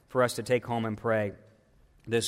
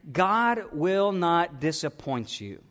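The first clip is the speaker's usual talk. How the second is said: usual speaking voice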